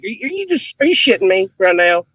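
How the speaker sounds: high mock-incredulous voice, amused; imitated disbelief. Guest mimicking stunned fans after laughing, high incredulous voice.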